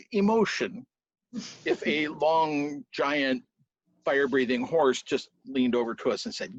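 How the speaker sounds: ordinary delivery, nothing marked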